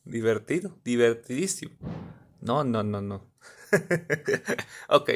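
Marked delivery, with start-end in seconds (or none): 3.7-4.6 short, soft laugh; chuckling. Real, not acted. Viewer wrapping up the funny video, soft chuckle. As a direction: chuckle lightly to yourself.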